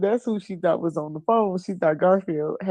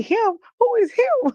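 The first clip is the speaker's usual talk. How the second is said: incredulous boyfriend, imitated; 3 dB louder than usual. Retelling boyfriend's suspicious questioning.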